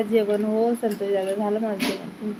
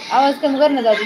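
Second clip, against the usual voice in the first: calling out; 4 semitones higher, 8 dB louder than usual. Real, not acted. Asks brother to lower volume.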